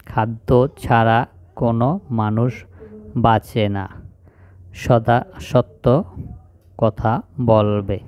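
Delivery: slow, word by word reading; slow example sentence. Shown as a demonstration. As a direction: read the sentence out slowly for the class. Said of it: "এখানে দেখতেছি". (ধীরে ধীরে বাক্যটি পড়া)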